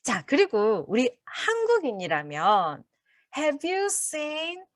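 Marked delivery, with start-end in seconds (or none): 3.4-4.6 awkward learner. Shown as a demonstration. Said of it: "굉장히 어색하고 낯설고".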